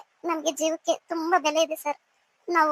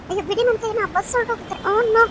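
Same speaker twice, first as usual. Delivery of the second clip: higher, rising to a loud cry of dismay; alarmed protest; 4 semitones higher, 5 dB louder than usual. Acted. Woman grabbed by her lover demands release, alarmed protest. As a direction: protest in alarm, louder by the end.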